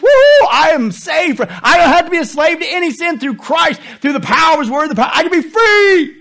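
high, loud, jubilant cry; joyful shout. Real, not acted. Preacher celebrating liberty in Christ with a loud joyful shout. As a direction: shout the joy of being set free.

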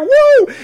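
very high, loud "woo"; hammed-up swooning whoop; excited whoop. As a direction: let out a piercing cheer. Whoops over the man described.

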